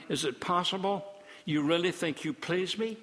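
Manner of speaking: ordinary talk, nothing marked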